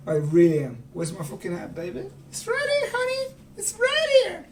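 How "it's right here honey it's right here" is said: very high crying falsetto; mock Madame Lesueur. Answering him about his hat, a very high tearful falsetto. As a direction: shriek it like a tearful woman.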